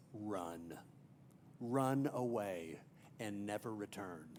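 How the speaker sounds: low menacing order to a frightened cub; deep sinister Scar impression. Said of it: "most sinister voice ever".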